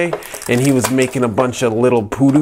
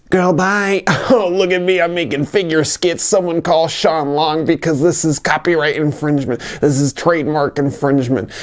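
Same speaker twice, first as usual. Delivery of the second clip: campy diva voice; 6 semitones higher than usual. Pretend. Campy high skit voice.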